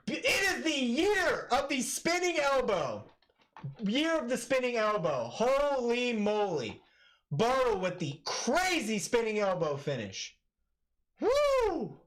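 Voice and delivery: high, hyped commentary; excited. Real, not acted. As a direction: keep riding the hype. Still celebrating the finish.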